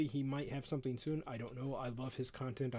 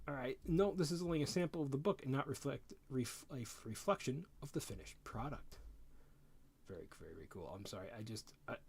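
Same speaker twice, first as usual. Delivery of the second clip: normal talking voice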